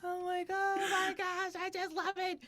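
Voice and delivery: very high, loud gushing; laughing delight. Real, not acted. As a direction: gush at the top of your pitch, cracking up. Gushing while cracking up.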